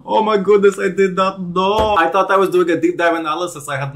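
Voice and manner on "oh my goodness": usual speaking voice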